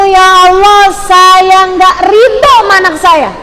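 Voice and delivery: high, loud, drawn-out rejection; forbidden angry prayer. Shown as a demonstration. The prayer a mother must never say, high and forceful. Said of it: "Jangan sampai keluar dari mulutmu".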